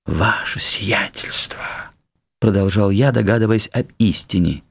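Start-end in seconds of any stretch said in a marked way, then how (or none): 0.0-1.6 slow, breathy near-whisper; half-whispered, drawn-out address; hushed realization. Acted. Guest guessing the truth to the count, hushed.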